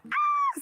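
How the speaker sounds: high, loud yelp of fright; high startled scream. Retold or imitated. Retells screaming at a bug.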